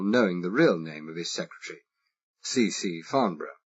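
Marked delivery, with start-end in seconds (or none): none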